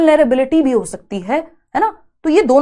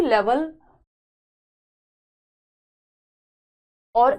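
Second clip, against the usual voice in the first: slow dictation pace, words spaced out for note-taking; measured, spaced classroom dictation; much slower than usual. Acted. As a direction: dictate it so students can write along. Dictating notes to students.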